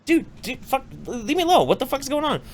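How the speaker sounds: high-pitched mock irritation; acted annoyed brush-off. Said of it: "I'm like".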